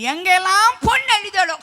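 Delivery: loud, high rising proclamation; emphatic preaching. Real, not acted. Preacher telling where any woman wept, emphatic cry. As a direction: belt it out to the congregation.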